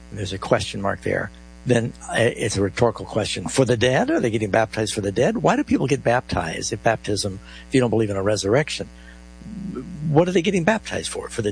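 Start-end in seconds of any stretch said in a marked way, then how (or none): none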